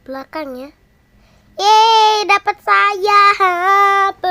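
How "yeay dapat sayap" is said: loud, high cheer; excited. Real, not acted. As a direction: cheer out the words. Excited at getting wings.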